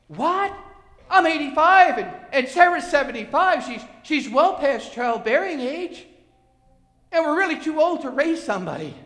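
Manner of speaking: high, loud incredulous protest; acted disbelief. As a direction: protest to God as a stunned old Abraham. Acts out Abraham's disbelieving objection.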